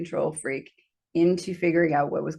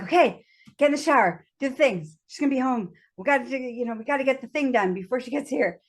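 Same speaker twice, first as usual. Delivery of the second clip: higher, hurried to-do list; lightly acted fluster, pitched up; retold urgency; 6 semitones higher, faster than usual. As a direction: rattle off the orders like time is short. Acting out urgency before she returns.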